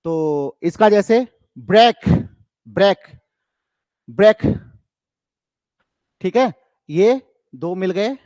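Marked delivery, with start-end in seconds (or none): 1.6-4.4 "break" punched loud, each one separate; each "break" a single strong beat; stressed-syllable demo. Shown as a demonstration. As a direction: hit every "break" equally hard, with pauses between. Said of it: "केवल दो stress syllable आपको दिखें".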